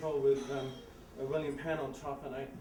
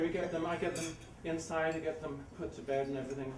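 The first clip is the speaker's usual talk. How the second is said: ordinary talk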